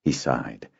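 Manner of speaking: ordinary delivery, nothing marked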